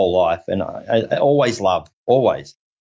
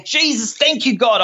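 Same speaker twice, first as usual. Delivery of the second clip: high, overjoyed praise; ecstatic thanks; 11 semitones higher than usual. Retold or imitated. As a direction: cry out your thanks, overwhelmed. Retold ecstatic thanks to God.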